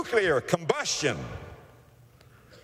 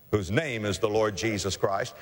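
ordinary talk

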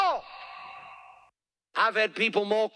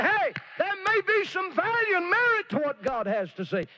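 much higher, straining near a cry; pleading; 12 semitones higher than usual. Acted. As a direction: beg them to give God a hearing. Pleading with mockers.

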